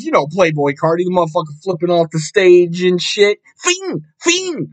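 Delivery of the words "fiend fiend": very high, light rapper ad-lib; imitated squeaky baby-voice ad-lib. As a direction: squeak it like a rap hype ad-lib. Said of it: "that's my best [name] impression".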